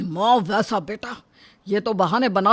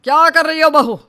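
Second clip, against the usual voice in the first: sharp high shout at the daughter-in-law; angry shout; 7 semitones higher, 5 dB louder than usual. Acted. Father-in-law shouting at the bride over the curry. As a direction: yell out in anger. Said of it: "चिल्लाता है".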